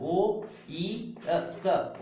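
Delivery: ओइक said very slowly, then spelt ओ ई क letter by letter; slow spelling. Shown as a demonstration. Teacher to class, spelling the suffix slowly. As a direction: stretch each letter for note-taking. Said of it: "teacher spelling out suffix".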